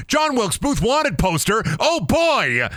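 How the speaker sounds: light up at the find; gleeful high outburst; excited exclamation. Real, not acted. Excited at finding poster.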